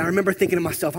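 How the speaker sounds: ordinary talk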